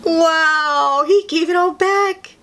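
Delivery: ordinary delivery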